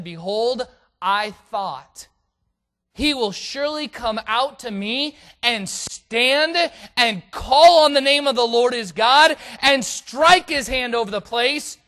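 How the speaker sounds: loud, high-pitched indignant declamation; wrathful reading. A narrator's reading. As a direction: read Naaman's wrath aloud, building to a booming high peak. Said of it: "But Naaman was wroth".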